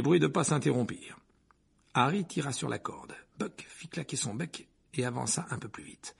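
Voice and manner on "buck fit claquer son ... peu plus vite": usual speaking voice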